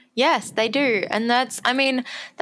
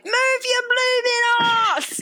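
high, put-on Cockney yelp, slower; Cockney shout; 14 semitones higher, slower than usual. Eliza at the races, suddenly in broad Cockney. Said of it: "she comes out with".

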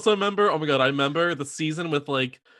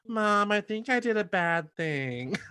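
high, soft, slow little confession; guilty daughter impression; 6 semitones higher, 5 dB quieter, slower than usual. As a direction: imitate a girl confessing to her mother. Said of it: "her on the phone with her mom".

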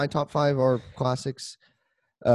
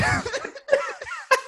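high burst of laughter; 5 dB quieter than usual. Real, not acted. Laughing at Google result.

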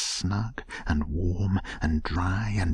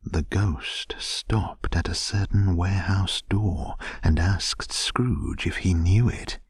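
plain reading voice

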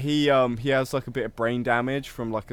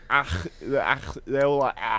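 Scouse ach sound; 2 semitones higher, 3 dB louder than usual. Retold or imitated. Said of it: "what's Liverpudlians like".

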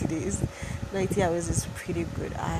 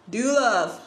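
high, loud manic villain cry; unhinged villain; 5 semitones higher, 7 dB louder than usual. Pretend. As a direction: ham it up like a cartoon villain. Repeated phrase, rising intensity.

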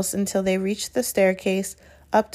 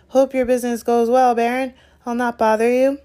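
high, obsequious farewell; servile, higher-pitched pleasantry; fawning; 5 semitones higher, 4 dB louder than usual. Acted. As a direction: sign off eagerly to a feared superior. Peeves fawning as he leaves.

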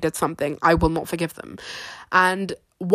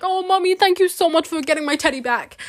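put-on high, sweet childish thanks; little girl voice; 9 semitones higher than usual. Retelling her six-year-old self thanking mum, high childish voice. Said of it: "I'd be like".

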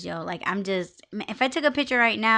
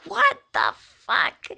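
shocked reaction; 12 semitones higher than usual. Retold or imitated. Seeing her hair in window.

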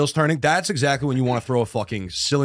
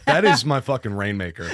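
ordinary talk, nothing marked

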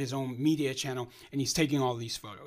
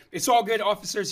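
raised voice calling over to officers, higher and louder; 4 semitones higher, 7 dB louder than usual. Retold or imitated. Calling to the officers to calm the arrest, raised voice. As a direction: call out to the cops, reassuring but loud.

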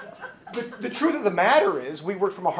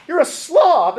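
higher, louder jab; a hammed-up higher spouse barb; mock spouse insult; 9 semitones higher, 6 dB louder, slower than usual. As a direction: snipe it like a nagging partner.

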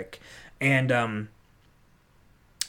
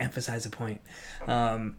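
usual speaking voice

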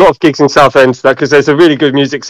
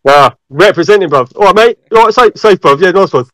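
hammed-up Essex geezer; Essex accent; 4 semitones higher than usual. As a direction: lay on the Essex lad slang. Hamming Essex lad greetings.

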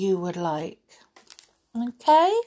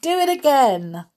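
excited; 7 semitones higher, 5 dB louder than usual. Acted. Mowgli begging.

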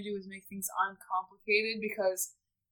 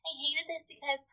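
lightly nasal mocking confession; snide, nasal voice of a bitter contestant; Jessica impression; 4 semitones higher, 9 dB quieter than usual. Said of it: "Jessica impression".

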